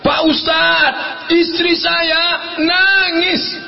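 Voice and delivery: high, drawn-out mock wail; complaining husband. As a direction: stretch the complaint out high and slow. Distressed husband complains to the preacher. (suami mengadu, melengking panjang)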